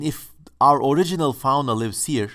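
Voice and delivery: ordinary talk, nothing marked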